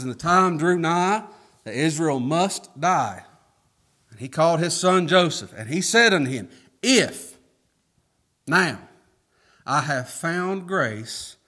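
preacher reading Genesis aloud. Acted. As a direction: read the text out solemnly.

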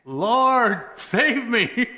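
very high, loud, stretched-out plea; desperate cry for help. Retold or imitated. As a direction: call out to heaven in hammed-up desperation.

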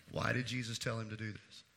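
ordinary talk, nothing marked

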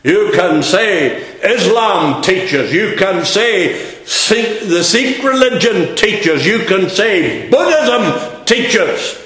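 loud, high, ringing pulpit preaching; preaching at full force. Acted. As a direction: hammer it home to the congregation.

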